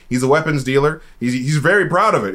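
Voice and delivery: ordinary delivery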